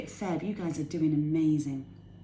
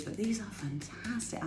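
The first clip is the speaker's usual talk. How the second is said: whispered; 2 semitones higher, 6 dB quieter than usual. Real, not acted. Confiding aside over music.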